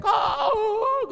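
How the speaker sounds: put-on high, wailing cry of a distraught girl; hysterical crying out. Imitating the hysterical girl being prayed over, wailing high. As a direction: wail it like she's sobbing in despair. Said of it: "teriak-teriak".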